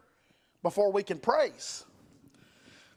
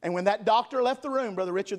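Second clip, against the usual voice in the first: normal talking voice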